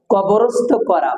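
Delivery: ordinary delivery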